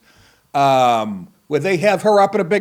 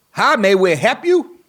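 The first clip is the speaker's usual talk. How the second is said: cheerful staff greeting; 6 semitones higher, 5 dB louder than usual. Retold or imitated. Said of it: "they do this all at once, in unison".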